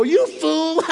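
high scornful jeer then cackle; mock him with a laugh. Imagined atheist coworker jeering at him, mocking laugh. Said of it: "She's just gonna laugh and go".

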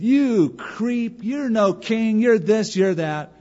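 high-pitched mock tirade; retold angry name-calling; acted cursing. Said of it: "starts cursing David".

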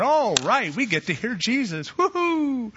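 mock cheering crowd, rising to a high woohoo; playing the eager crowd thrilled to hear Jesus; excited crowd. Preacher voicing the crowd, excited cheer.

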